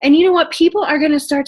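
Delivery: high, loud, almost tearful plea; emotional invitation near tears; heartfelt excitement. Real, not acted. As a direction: plead with real feeling. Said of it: "I am so excited".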